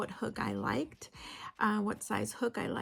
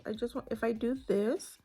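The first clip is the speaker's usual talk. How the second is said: ordinary delivery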